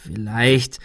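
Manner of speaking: zögerliches Ansetzen; zögernd. A narrator's reading. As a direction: setz unsicher an und halte inne. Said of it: "Arthur zögerte". (zögerlich)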